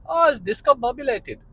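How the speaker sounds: put-on playful Indian accent on the long word. Copying his friend from India in an Indian accent. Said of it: "I had a friend from India".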